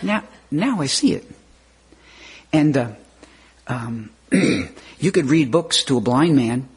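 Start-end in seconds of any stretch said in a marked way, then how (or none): 0.0-1.2 higher, delighted ah of discovery; sudden realization. Retold or imitated. Someone grasping the truth at last, pitched up with delight. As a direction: let the light dawn on you.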